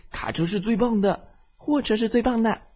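put-on high childish bickering. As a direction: argue back and forth. Trucks bicker again. (争吵)

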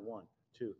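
whispered count under the breath; soft awed whisper counting cars. Retold or imitated. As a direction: count them off in a hushed whisper. Counting police cars at funeral.

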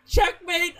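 high loud triumphant call. Retold or imitated. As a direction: announce it loudly, triumphant. Retold opponent declaring checkmate.

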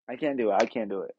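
ordinary talk, nothing marked

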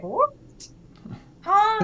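startled high squeak on Huh; mock disbelief, pitch jumping way up; baffled double take, voice shooting up; surprised huh. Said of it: "he always like".

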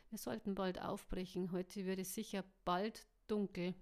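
usual speaking voice

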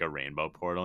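ordinary talk

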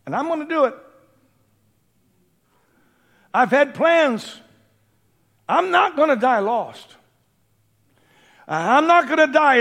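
high, loud, whiny voice promising to the preacher; procrastinating churchgoer. Retold or imitated. Churchgoer promising the preacher he'll do it, high and loud. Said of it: "just by procrastination".

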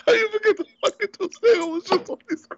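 incredulous. Real, not acted. Teasing friend for forgetting.

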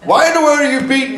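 high voiced donkey, pleading; talking donkey. Donkey asks its master why he beats it. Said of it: "the donkey turns around and says".

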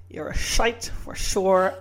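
slow Connery voice, "shite" for sight; Sean Connery impression. Shown as a demonstration. Host retries Connery line for co-host: slow Scottish slur. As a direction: drag it out slowly, slurring s into sh. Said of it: "All the S's are sh, sh sounds".

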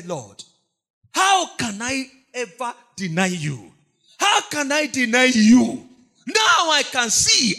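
pleading. Acted. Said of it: "he will cry and cry and say".

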